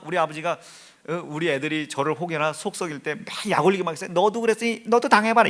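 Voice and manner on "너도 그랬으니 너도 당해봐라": mock taunt. Imagined parents taunting the son.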